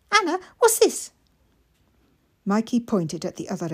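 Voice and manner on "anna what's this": put-on high, eager small boy; little boy voice. Mikey asking his sister, high and eager. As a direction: ask it wide-eyed like a small child.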